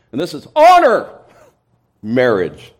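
preacher shows Greek word order by shouting "honor" first; stress on honor. Shown as a demonstration. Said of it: "if they wanted to emphasize something".

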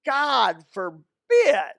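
emphatic declamation. Acted. Preacher giving Paul's answer on continuing in sin, emphatic declamation. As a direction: thunder the refusal, stretching each word.

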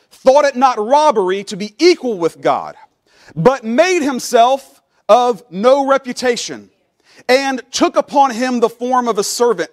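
high, loud, fervent scripture reading; full-voiced pulpit reading, building; preaching at full force. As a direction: lift the verses up with rising fervor. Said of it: "I want you to kind of feel what this is".

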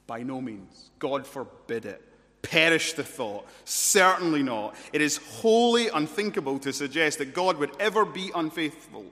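builds from plain to a loud, insistent denial; emphatic no. Retold or imitated. Paul rejecting the objection, mounting emphatic denial. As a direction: refuse it harder with every phrase. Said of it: "Paul responds with an emphatic no".

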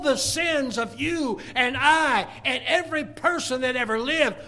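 high, forceful, building pulpit voice; impassioned preaching. Acted. Preacher on sins laid upon Jesus, impassioned. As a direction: press the horror on the congregation.

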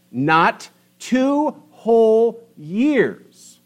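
not two whole years, high and very slow; emphasis on two whole years. Shown as a demonstration. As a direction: stretch each word of two whole years. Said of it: "There's emphasis there".

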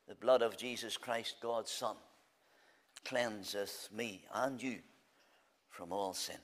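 low, soft and slowing; hushed scripture. Acted. Preacher closing the appeal to the unsaved, low and soft. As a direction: let the verse sink quietly.

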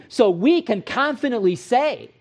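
ordinary talk

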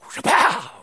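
loud, high explosive "shapow"; imitated whip crack. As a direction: fire it off like a lash landing. Imitating the blow of a whipping.

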